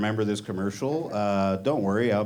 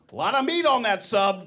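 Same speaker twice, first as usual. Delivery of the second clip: woman screaming; 11 semitones higher than usual. Retold or imitated. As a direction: holler it like an excited neighbour lady. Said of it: "a woman screams".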